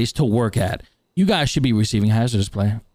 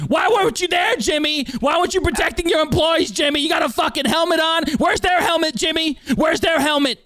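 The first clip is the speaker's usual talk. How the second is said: angry shouting. Acted. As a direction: tear into him, demanding answers. Host berates Jimmy about protection.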